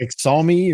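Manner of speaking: "Xiaomi" guessed as "ex-saw-mee"; unsure pronunciation. Real, not acted. Co-host guessing at a phone brand, unsure pronunciation of "Xiaomi" as ex-saw-mee. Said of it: "I don't know how you pronounce it".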